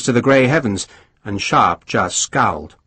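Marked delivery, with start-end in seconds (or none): none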